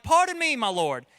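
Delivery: put-on over-polite British accent, starting high and loud; mock British politeness. Polite Israelites standing before the Lord, in a mock British accent. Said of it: "read it in a British accent".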